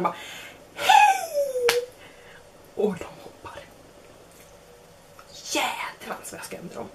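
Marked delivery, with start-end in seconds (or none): 0.7-1.9 sudden high, loud chirpy hey; jack-in-the-box hello. Retold or imitated. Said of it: "som gubben i lådan". 3.0-6.9 whisper. Real, not acted. Whispered aside after the scare.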